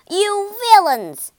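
loud, high angry yell; furious shout. A narrator's reading. Rat yelling at the passing car, furious shout. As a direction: shout it in fury. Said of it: "he shouted".

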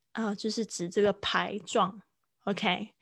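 ordinary talk, nothing marked

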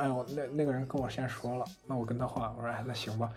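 dejected. Retold or imitated. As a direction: let the air out of it, giving up. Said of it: "很沮丧". (沮丧地低声说)

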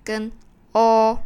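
loud, open 哦; strong vowel o. Shown as a demonstration. Teacher naming the strong vowels, stressing o. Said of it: "强元音就是诶".

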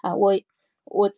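usual speaking voice

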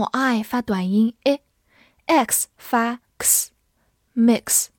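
slow, separated sounds of "mix"; phonics demonstration. Shown as a demonstration. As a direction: sound out "I" as a short vowel and "X" as ks, then say "mix". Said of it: "字母I发短音I".

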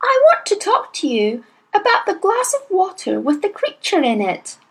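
put-on high, earnest child's voice pleading innocence; a small girl anxious to be believed; little girl Matilda. A narrator's reading. Matilda's lines in the story.

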